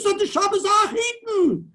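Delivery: put-on high, loud, slow wailing cry of an old rebbe; imitating the Minchas Elazar's cry. Rabbi reenacting the rebbe's wedding speech, high wailing shout. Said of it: "That's the way he said it".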